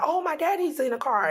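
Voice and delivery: put-on high little-boy voice, lifting up bright; excited little boy. Mother voicing her toddler son, high and excited. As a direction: imitate a toddler spotting something he loves. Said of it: "And he's like".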